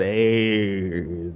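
drawn out long and low; stretched out. Shown as a demonstration. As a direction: hold the word aids long. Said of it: "Stretch it out".

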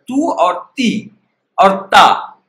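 Tu, ti and ta spelled out slowly, each ending vowel drawn out; ending sounds demo. Shown as a demonstration. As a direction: sound out the three endings Tu, ti, ta one by one. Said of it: "how we will pronounce the last letter". (slow teacher demo of the endings Tu, ti, ta)

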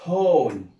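هول said loud as hol, like English hall; loud classroom model of هول; model pronunciation. Shown as a demonstration. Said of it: "يبقى اسمها".

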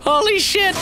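very high shocked exclamation; startled shrieks. Real, not acted. Gamer shocked by a tough enemy, high startled yell.

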